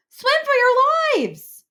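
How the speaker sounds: high, loud, slow terrified shout; panicked scream. A narrator's reading. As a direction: scream it to get everyone swimming. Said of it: "cried Joey Mackerel".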